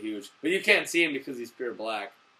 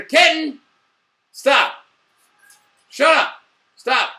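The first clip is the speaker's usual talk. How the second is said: loud, high sharp scolding of a pet; shouting at the cat, higher and louder; 9 semitones higher, 7 dB louder, slower than usual. Real, not acted. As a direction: yell at the cat to quit it. Scolding the cat mid-video.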